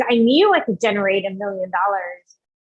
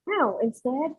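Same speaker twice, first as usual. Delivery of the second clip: ordinary delivery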